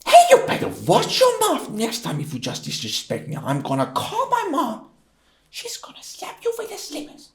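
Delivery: very high, squeaky put-on voice; Spanish guy. Shown as a demonstration. Guy threatens to call his mom, squeaky mock threat. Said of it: "he was a Spanish guy".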